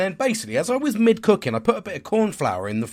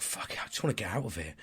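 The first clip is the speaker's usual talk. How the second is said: muttered wish; 5 semitones lower, 12 dB quieter, faster than usual. Retold or imitated. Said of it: "I'm like".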